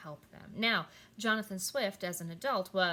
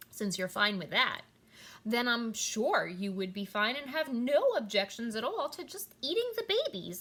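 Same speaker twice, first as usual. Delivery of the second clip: sarcasm; 2 semitones higher, 4 dB louder than usual. Pretend. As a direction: sneer it out as a mock-helpful proposal.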